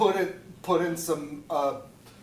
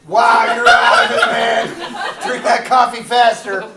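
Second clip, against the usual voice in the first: loud teasing; 9 dB louder than usual. Acted. Scene partner teasing loudly.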